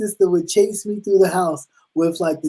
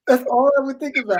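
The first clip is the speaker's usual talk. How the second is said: ordinary delivery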